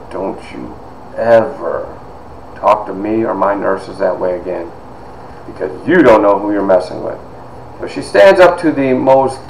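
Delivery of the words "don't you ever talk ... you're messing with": low-key, measured threat with heavy pauses; quiet warning. Retold or imitated. Head nurse standing up to a mean doctor, quietly stern. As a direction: lay down the warning softly, word by word. Mother warns domineering doctor.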